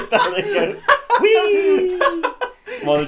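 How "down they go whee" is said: squealed whee, very high and loud, laughing; mock gleeful whee. Retelling the EPA men sliding down the pole, high gleeful squeal. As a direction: squeal it like a kid on a slide.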